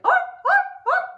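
very high, loud, short yelps; peacock yelping. Shown as a demonstration. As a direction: yelp like a peacock. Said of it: "What do you think a peacock yelping sounds like?".

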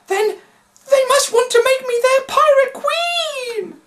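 very high, loud, fussy woman's voice; fretful lady. A narrator's reading. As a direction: squeal it like a vain lady. Annie Beth frets, voiced high.

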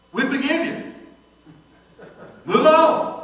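high, loud, far-off voice of the vice president; retold boss pronouncing forgiveness; boss's verdict. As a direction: hand down the pardon with authority.

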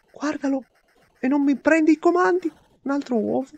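very high exasperated yelling; explosive italian cursing. Real, not acted. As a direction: shriek at the broken controls. Gamer loses control, exasperated.

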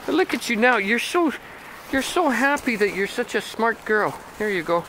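high sing-song praise; pet talk. Real, not acted. Owner telling the dog how smart she is, high sing-song. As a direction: gush at the dog.

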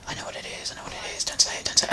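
whispered under the breath, barely audible; urgent whisper to self. Retold or imitated. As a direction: hold it back in a hushed whisper. Silently urging himself to hold back.

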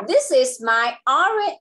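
slow, careful teacher read; slow model sentence. Shown as a demonstration. Tutor modelling a sentence, slow and clear. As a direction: lay out "This is my orange" slowly for copying. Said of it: "Now you say".

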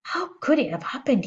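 high, dismayed demand; yelled. A narrator's reading. Father rounding on his family, yelled. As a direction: cry out high in disbelief. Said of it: "he yelled".

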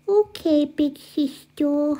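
put-on baby voice. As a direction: drag it out like a toddler. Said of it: "stop talking in that stupid baby voice".

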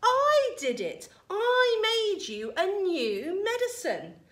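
high, loud, drawn-out insistence; shouting back. A narrator's reading. George claiming the medicine to Grandma, shouting back. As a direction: insist loudly, leaning on each word. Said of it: "George shouted back at her".

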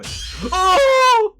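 sharp high shout of fright; startled shout. Real, not acted. Streamer ambushed by the grandma, sudden high shout. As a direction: cry out as the scare lands.

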